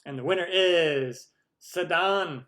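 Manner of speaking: loud, drawn-out prize announcement; stretched-out announcer reveal; winner announcement. Real, not acted.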